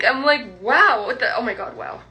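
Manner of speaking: normal talking voice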